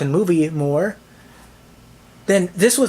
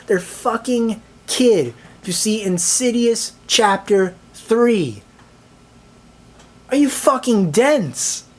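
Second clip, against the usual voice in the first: slow incredulous rant; 5 semitones higher, slower than usual. Real, not acted. Ranting about a kid at a horror film.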